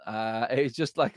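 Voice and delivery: ordinary talk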